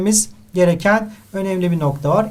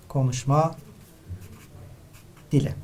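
softer, spaced-out dictation; dictated answer; 2 semitones lower, 8 dB quieter than usual. Shown as a demonstration. Teacher dictating the answer for students to write, soft. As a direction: let the answer sink in quietly. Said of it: "Konuşma dili denir".

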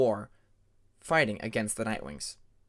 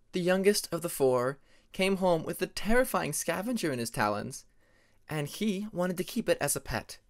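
Tempest, lively storyteller; 5 semitones higher than usual. Acted. Tempest recounting her brother's scavenger chaos to Peacemaker, higher, animated. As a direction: tell the pet-scavenger mishap with gusto in a higher voice.

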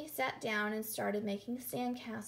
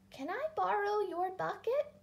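high-pitched elf woman asking; 9 semitones higher than usual. A narrator's reading. Elf woman asks Emily for a favor, high voice. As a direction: ask in a high little elf voice. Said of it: "An elf woman tugged".

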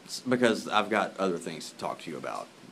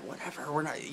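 ordinary talk

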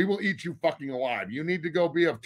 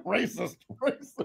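laughing mock accusation; 7 semitones higher, 6 dB quieter than usual. Real, not acted.

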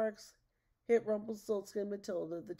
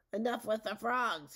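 loud, raised-pitch outburst; yelled; 4 semitones higher, 6 dB louder than usual. A narrator's reading. Rumpelstiltskin yanks the crystal off the stick, fed-up yell. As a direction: snap it out in a raised voice. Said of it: "he yelled".

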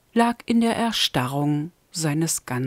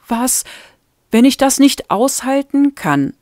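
pitched up, louder, bursting out in panic; blurted out; 7 semitones higher, 4 dB louder than usual. A narrator's reading. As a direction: let the fear burst out of her. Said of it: "platzte sie raus". (panisch herausgeplatzt)

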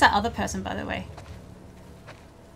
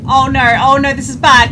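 being chased; 3 semitones higher, 12 dB louder than usual. Real, not acted. Enemies closing in.

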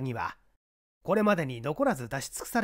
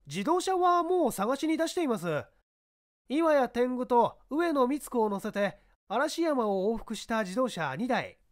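eager subordinate; 9 semitones higher, 5 dB louder than usual. Acted. Subordinate reports to the inspector, high and eager. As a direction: report back keenly to the boss.

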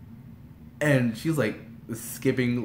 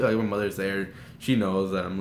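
normal talking voice

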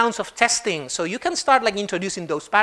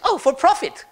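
lightly voiced gnome; 7 semitones higher than usual. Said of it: "the gnome says".

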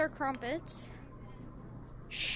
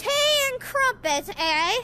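a hammed-up hint of posh British; mock British accent; 8 semitones higher, 9 dB louder than usual. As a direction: toss off a jokey British tea-time line. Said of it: "I'm not British".

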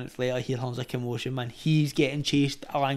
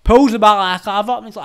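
ordinary delivery

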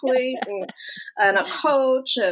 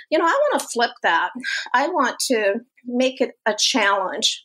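usual speaking voice

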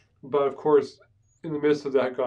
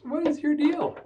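much higher incredulous challenge; exasperated; 13 semitones higher than usual. Retold or imitated. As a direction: demand it in disbelief. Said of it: "God says".

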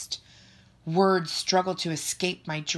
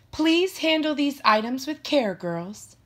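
higher, louder teacher voice; teacher's caution; 9 semitones higher, 4 dB louder than usual. A narrator's reading. Miss Grant hands out a warning to students, teacherly caution. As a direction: address the class as a careful teacher.

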